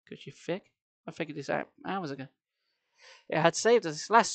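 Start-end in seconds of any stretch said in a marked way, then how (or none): none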